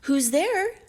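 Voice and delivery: high, small, wavering granny; an old sick granny calling through the door; lightly voiced frail granny; small shaky voice. A narrator's reading. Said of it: "in a small, shaky voice".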